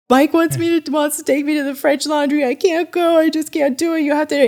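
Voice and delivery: imitating a high-pitched frantic plea to her husband; retold panic. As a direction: act out an overwrought, high-pitched protest.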